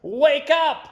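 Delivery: high, loud yell. A narrator's reading. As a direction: shout it as loud as you can. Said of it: "yelled at Amy as loud as she could".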